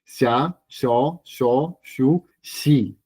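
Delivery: Sha, sho, sho, shu, shi with a clear SH sound; SH syllables. Shown as a demonstration. Teacher drilling SH syllables for students. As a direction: drill each syllable with SH, evenly. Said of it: "la pronunciamos como una S H".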